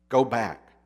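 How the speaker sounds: ordinary talk, nothing marked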